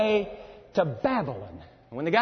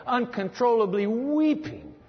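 ordinary delivery

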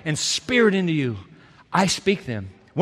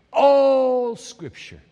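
slow, hushed awe; 4 semitones higher, much slower than usual. Acted. Preacher dwelling on the phrase.

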